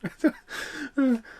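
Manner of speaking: high-pitched burst of laughter. Real, not acted. Laughing at his frozen action figure, high laugh. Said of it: "laughs at frozen figure".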